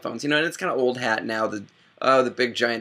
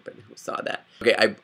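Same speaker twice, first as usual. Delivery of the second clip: ordinary talk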